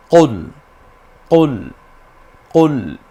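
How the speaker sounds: কুল repeated loud from the throat; throaty qul. Shown as a demonstration. Teacher leads students repeating কুল. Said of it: "আমার সাথে একটু মিলিয়ে পড়ুন".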